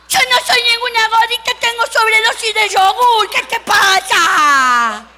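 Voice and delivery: high, loud, offended protest; indignant yell. Acted. Girl yells at the auctioneer, loud and indignant. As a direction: snap back, outraged and loud.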